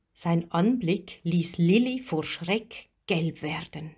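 usual speaking voice

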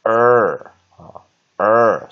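er loud and drawn out; R-controlled vowel. Shown as a demonstration. Teacher showing what U R sounds like, long loud er. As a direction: hold er long with the r colouring. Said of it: "R control U R".

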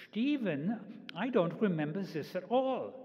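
put-on high-pitched German-accented mother brushing off her son; mother's heavy German accent. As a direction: imitate an emphatic German mother's denial. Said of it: "in her heavy German accent".

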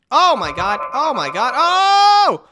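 high, loud startled shouts; shocked yelling. Real, not acted. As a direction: yell in alarm as the car flips. Car crashing wildly in game.

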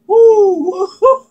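loud, laughing woo; excited whoop. Acted. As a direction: whoop with delight at the toy. Whooping over his toy.

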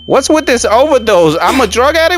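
high, loud protest breaking into laughter; laughing disbelief. Real, not acted. Player killed by overdose again, laughing disbelief. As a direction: complain at the top of the voice, cracking up.

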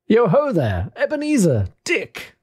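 jovial, high-pitched hearty call; Fezziwig. Acted. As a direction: call out in a rich, fat, jovial voice. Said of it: "called out in a comfortable, oily, rich, fat, jovial voice".

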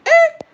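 sudden very high, loud squeal; piercing high squeak of surprise; shocked "eh?". Real, not acted. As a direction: yelp it in disbelief. Reacting to a late interview arrival.

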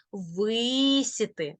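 Висіти with drawn-out stressed Ви; stress on first syllable. Shown as a demonstration. Teacher demonstrating first-syllable stress. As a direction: slowly stretch Ви in Висіти. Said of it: "наголос на перший склад".

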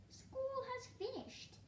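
high slow bird voice; magpie voice. Acted. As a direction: chirp it in the magpie voice.

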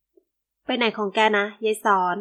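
usual speaking voice

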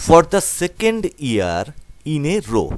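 each English word read out very slowly; slow reading aloud. Shown as a demonstration. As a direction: spell out the line slowly for learners. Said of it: "এটা শুরু হচ্ছে এইভাবে". (ধীরে ধীরে পড়া)

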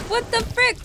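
high, loud bewilderment; startled outburst. Real, not acted. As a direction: blurt it out, taken aback. Startled by something in game.

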